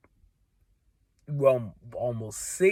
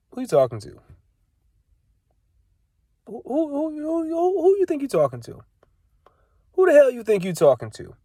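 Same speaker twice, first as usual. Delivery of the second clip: high, stammering who-who before the challenge; sputter, then challenge him; indignant outrage; 10 semitones higher than usual. Retold or imitated. Confronting the stranger who called him youngster, high indignant sputter.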